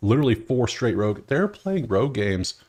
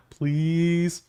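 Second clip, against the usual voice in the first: "Please." drawn out, higher and begging; pleading; 7 semitones higher than usual. Real, not acted. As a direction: beg for it, half joking. Begging for a real game.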